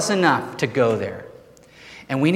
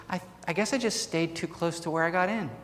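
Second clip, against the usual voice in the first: put-on higher, much softer childlike voice; innocent little girl; 4 semitones higher, 11 dB quieter than usual. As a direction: answer shyly like a small girl.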